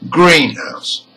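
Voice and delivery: "greenhouse" with GREEN high, house unstressed; stress on GREEN. Shown as a demonstration. Teacher demonstrates a compound word, "greenhouse" stressed on GREEN. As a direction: hit the first part of "greenhouse" and let house drop. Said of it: "the stress is on the first part".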